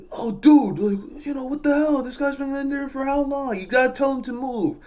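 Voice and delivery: high-pitched mock trucker complaint; whiny mock complainer. Honking trucker demanding a move, high mock complaint. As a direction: imitate an impatient driver way up high.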